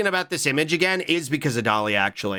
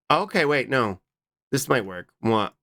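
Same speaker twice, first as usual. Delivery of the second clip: ordinary delivery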